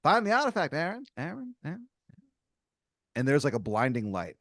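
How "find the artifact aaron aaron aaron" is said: high, echoing call of an unseen spirit; ghostly summons. Acted. Mysterious voice from beyond summoning Aaron, high haunting call. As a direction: call his name from another world, rising at the end. Disembodied voice calls the player.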